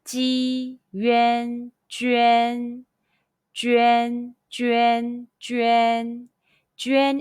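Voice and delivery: "Ji, yuan, juan" all held on a high level tone; "juan" on a high, flat first tone. Shown as a demonstration. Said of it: "Repeat after me".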